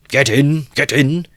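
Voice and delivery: high, loud bellow to the crowd; drunken shout. Acted. Young peasant calling the crowd into his cart, loud shout. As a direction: roar it out, rowdy and drunk. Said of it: "shouted one of them".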